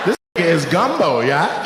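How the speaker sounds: normal talking voice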